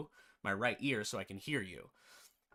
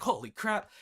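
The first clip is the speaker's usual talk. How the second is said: high, louder mock-startled exclamation; retold startled outburst; 7 semitones higher, 5 dB louder than usual. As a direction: blurt it as if jolted by noise. Retold shock at sudden loudness.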